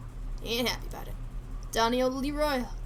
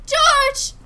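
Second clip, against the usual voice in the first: a shrieking cry, much higher and louder; wife screams as her husband is hurt; horrified scream; 13 semitones higher, 13 dB louder than usual. Acted. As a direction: scream his name in horror. Screaming a name in horror.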